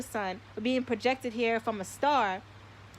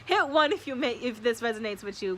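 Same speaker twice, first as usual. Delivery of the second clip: normal talking voice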